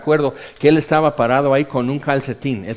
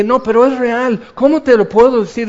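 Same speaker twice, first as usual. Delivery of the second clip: higher, louder, fast pleading; eager insistence; 9 semitones higher, 6 dB louder than usual. Retold or imitated. Teen pressing his school friend that it is real, eager. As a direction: urge a friend to believe you.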